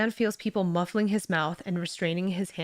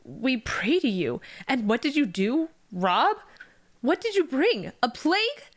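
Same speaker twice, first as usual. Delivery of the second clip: builds higher and louder to a cry; rising outrage; 6 semitones higher, 4 dB louder than usual. A narrator's reading. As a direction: hurl the accusation at the god. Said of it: "The man continues".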